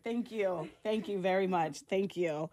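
thanking through real tears; tearful thanks. Real, not acted. Host thanking the Girl Scout leader, tearfully grateful. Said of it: "I'm not even a crier".

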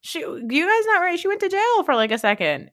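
can you believe this, up high; sarcastic high rant; incredulous. Acted. Ridiculing Paris's reputation.

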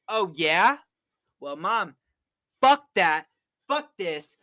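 loud, high, punched-out swearing; mock teenage defiance. Retelling himself at fourteen cursing at mom, loud mock defiance. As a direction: act out a teenager goading mom, each curse hit hard. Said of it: "And I was like".

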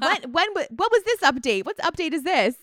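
high, bewildered questioning; confused about an old update; baffled. Retold or imitated. Herself puzzled at her phone's update, high and baffled. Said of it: "I'm like".

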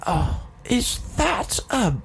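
slow, high hammed-up English accent; badly faked old English, drawn out; bad sixteenth-century English accent. Shown as a demonstration. Showing his really bad accent, sixteenth-century English. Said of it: "sixteenth century accent".

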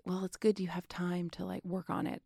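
soft, near-whispered imitation of tactful agents; retold lukewarm verdict, barely voiced; hushed, guarded agent. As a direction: break doubtful news very softly, as if imitating. Agents' tactful verdict after run-through.

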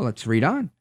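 normal talking voice

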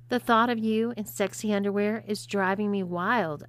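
ordinary delivery, nothing marked

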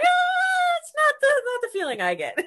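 falsetto high mock-innocent voice; hammed-up oblivious man; naive man. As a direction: squeak it out like a clueless fan. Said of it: "And he's like".

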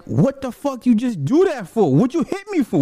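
indignant disbelief. Retold or imitated. Asking his girlfriend why she hit him, high and outraged. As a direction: demand an answer, stunned and hurt. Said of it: "and I'm like".

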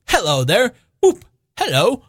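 high, giddy hallooing; elated high-pitched greeting cries; joyful whooping. A narrator's reading. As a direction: whoop out a merry hello. Scrooge whooping with joy.

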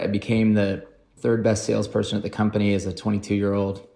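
ordinary talk

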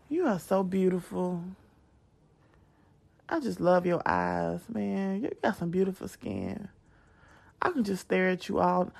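low, soft imitation of boyfriend; boyfriend's sweet talk. Woman mimicking boyfriend dodging deep talk with flattery, low sweet talk. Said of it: "that's him. That's him".

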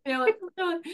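high, giggly admission; real amused giggle in the words; laughing. Real, not acted. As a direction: say it through a laugh. Laughs about her coming cold.

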